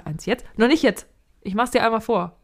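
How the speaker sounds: ordinary delivery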